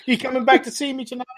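high, fast teasing barmaid voice; flirty barmaid. Acted. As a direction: tease him playfully. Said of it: "She goes".

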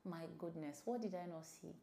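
usual speaking voice

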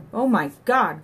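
horrified cry. A narrator's reading. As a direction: cry out in sudden horror. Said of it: "cried Johnny".

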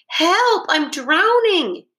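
high, drawn-out drowning wail; crying for help. A narrator's reading. Drowning toy rabbit calls out.

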